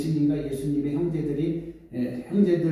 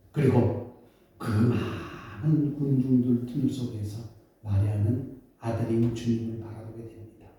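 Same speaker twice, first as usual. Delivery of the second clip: ordinary talk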